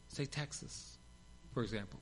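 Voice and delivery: wry whispered joke; whispered aside. Real, not acted. Preacher joking about Texas, whispered aside. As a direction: slip it in under the breath.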